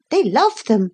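put-on high, eager little girl; a child jumping in to answer about bears; girl blurting out. A narrator's reading. Lucy insists.

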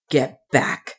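mildly stern man's warning. A narrator's reading. Man warning off a rushing woman, stern. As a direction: warn her off firmly. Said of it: "he said sternly".

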